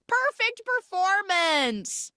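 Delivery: high, drawn-out rapturous praise; Dame Judi Dench cat. Retold or imitated. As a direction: exclaim it grandly, stretching every word. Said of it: "Dame Judi Dench literally kicks her leg".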